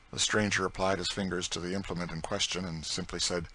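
usual speaking voice

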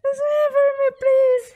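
high, loud begging; begging the cat to meow; pleading. Real, not acted.

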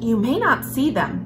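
usual speaking voice

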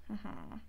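soft, low knowing mm-hmm; low sinister hum. A narrator's reading. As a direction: hum it quietly as a cruel queen. Witch's cruel smile, voiced.

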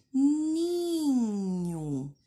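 Ninho, the final o said as U. Shown as a demonstration. Teacher showing final O sounds like U. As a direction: stress ni, end on a u sound. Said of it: "no final da palavrinha, ele vai ter o som de U".

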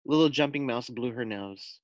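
ordinary delivery

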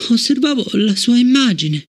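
put-on high girl's voice, a touch breathless; mildly panting child. Fallon recovering, lightly panting high child voice. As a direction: catch her breath after the shock.

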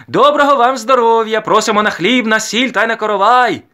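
high, loud, sustained call; calling out invitation. A narrator's reading. Woman inviting villagers to the wedding bread, calling loudly. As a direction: cry it out from house to house. Woman calls guests house to house.

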